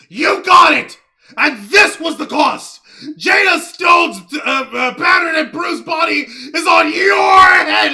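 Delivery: hammed-up outraged yelling; mock fury, voice cracking; shaking with anger. Mock outraged rant at Jose.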